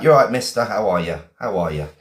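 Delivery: ordinary talk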